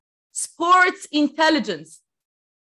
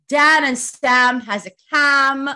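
1 slow imitation; 5 dB louder, much slower than usual. Shown as a demonstration. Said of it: "like this".

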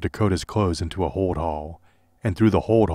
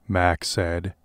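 plain reading voice

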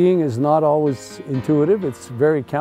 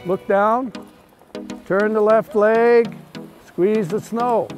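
cheery ski instructor shouting; 6 semitones higher, slower than usual. Real, not acted. Instructor calls cues during run.

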